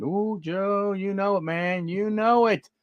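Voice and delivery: thrilled over the card; excited. Real, not acted. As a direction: collector delighted at a pull.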